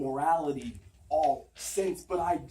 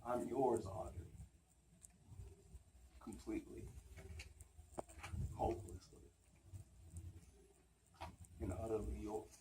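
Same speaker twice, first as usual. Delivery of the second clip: very soft, low declaration of love; intimate, halting stage murmur; hushed surrender; 9 semitones lower, 18 dB quieter than usual. Acted. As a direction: give yourself up, almost whispering, word by word. Monologue ends in surrender to love.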